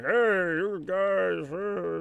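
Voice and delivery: very slow, half-hearted hey you guys; bored mock greeting. Greeting customers at Staples, mock bored drawl. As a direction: drag it out like a dead shift. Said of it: "standing around Staples being like".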